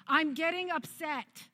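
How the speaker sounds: slow, high, drawn-out warning; high-pitched announcement. Retold or imitated. Mother warning her kids that she's getting upset, high and drawn out.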